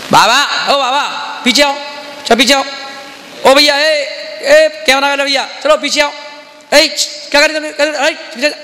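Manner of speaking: shouting. Shown as a demonstration. Monk demonstrates the wrong way, yelling at an elder and cameraman. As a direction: yell rudely at people to move back. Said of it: "ऐसे नहीं".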